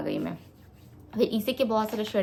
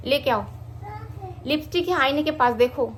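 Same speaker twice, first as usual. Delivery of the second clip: higher, raised voice to someone nearby; calling out instructions; 6 semitones higher than usual. Real, not acted. Mother sending child to fetch lipstick, calling out.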